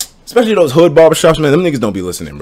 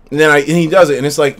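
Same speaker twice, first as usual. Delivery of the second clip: ordinary talk, nothing marked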